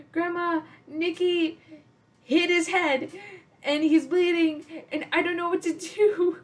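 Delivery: imitating a child blurting out bad news; panicked tattling. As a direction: rush to grandma in a mock panic. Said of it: "And I said, like".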